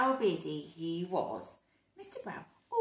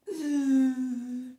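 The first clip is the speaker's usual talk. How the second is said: loud mock gasp; dramatic gasp; 4 semitones higher, 9 dB louder than usual. Reader gasping as the baby grabs the case. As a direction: gasp in pretend shock.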